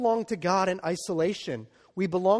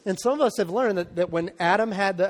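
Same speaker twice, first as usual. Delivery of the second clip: ordinary talk, nothing marked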